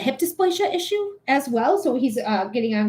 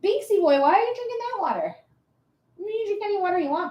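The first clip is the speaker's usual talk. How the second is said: higher, sing-song voice for the cat; pet talk to the cat; 8 semitones higher, faster than usual. Real, not acted. Asking her cat Salem about the water, in sing-song pet talk.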